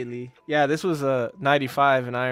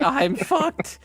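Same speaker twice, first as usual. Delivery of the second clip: mock panic; 11 semitones higher, 3 dB louder than usual. Retold Martin realizing trouble.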